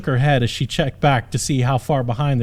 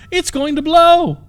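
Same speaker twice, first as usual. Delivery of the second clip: very high, loud, slow terrified scream; 13 semitones higher, 6 dB louder than usual. Acted. Princess warning the knight of fire, very high terrified scream.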